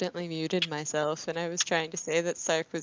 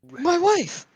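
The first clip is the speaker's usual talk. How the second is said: high, loud anguished cry; dramatic wail; 12 semitones higher, 7 dB louder than usual. Acted. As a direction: cry out for your lost wife.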